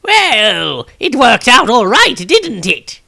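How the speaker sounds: put-on high, slow old man musing; old wizard voice. Old wizard eyeing his younger self, high and slow. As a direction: lift the voice up high like an ancient wizard looking back. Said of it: "said the robed newcomer".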